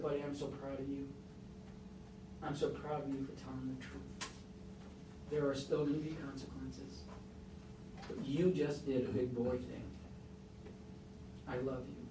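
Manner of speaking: soft, tender praise to a son; quiet, loving reassurance after tears; tender, proud. Retold or imitated. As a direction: hold him close and say it softly. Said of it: "I was crying now".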